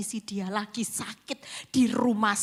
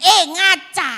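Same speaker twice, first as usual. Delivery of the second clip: loud, high scornful "Eh, ngaca" with a sharp falling end; sharp mocking jab; 9 semitones higher, 15 dB louder than usual. Wife snapping at her husband's mistress, loud scornful jab. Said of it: "saya bilang gini".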